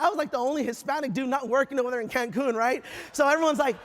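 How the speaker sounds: ordinary delivery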